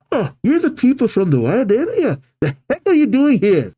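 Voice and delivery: high-pitched, affronted surprise; squeaky, indignant girl character; startled indignation. Acted. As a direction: confront the intruders in disbelief. Casey confronts intruding Team Yell.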